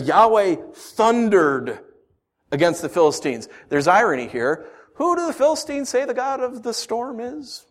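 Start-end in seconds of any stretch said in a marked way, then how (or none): 0.0-3.5 "Yahweh thundered" drawn out slowly; slow emphatic reading. Shown as a demonstration. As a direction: read the verse slowly and weightily. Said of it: "It says".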